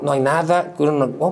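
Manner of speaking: normal talking voice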